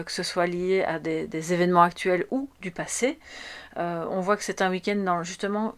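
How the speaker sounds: ordinary delivery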